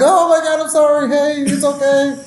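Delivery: high-pitched, flustered soothing; mock-panicked apology. Element leader calming the guy he startled, mock-panicked apology.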